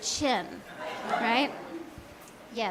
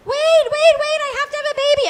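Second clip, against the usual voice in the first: much higher, louder, fast frantic wait wait; panicked pleading; 14 semitones higher, 8 dB louder than usual. Pretend. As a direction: shriek after the departing ride. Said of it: "they're like".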